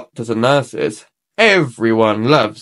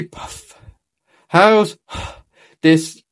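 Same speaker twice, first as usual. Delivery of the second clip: puffing, breathless words; winded from the course; out of breath; 3 semitones higher, much slower than usual. A narrator's reading. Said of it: "gasped Doctor Brown Bear".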